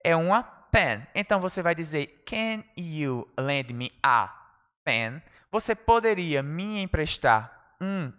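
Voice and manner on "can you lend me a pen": very slow, careful classroom English; slow model phrase. Shown as a demonstration. Teacher showing class how to borrow, very slow. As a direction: draw out each word for learners. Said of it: "teacher models phrase slowly".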